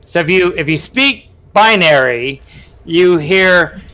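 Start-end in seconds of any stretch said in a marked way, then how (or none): none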